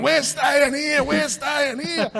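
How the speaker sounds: high, slow, roaring chant like a shirtless mad gorilla; mad gorilla shout. Retold or imitated. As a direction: bellow it from the desk like a crazed ape. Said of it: "just like a mad gorilla".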